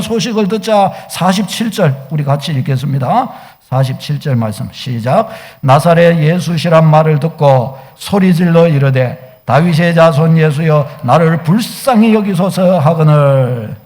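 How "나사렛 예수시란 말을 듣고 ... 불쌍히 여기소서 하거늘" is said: low, measured unison-reading voice; solemn recitation, low and even; congregational scripture reading. Real, not acted. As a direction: read the verse out firmly, drawing out each phrase. Reads verse with congregation.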